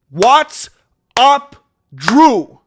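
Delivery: big, high, loud shout-out; loud hype greeting. Real, not acted. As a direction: call his name out like a host.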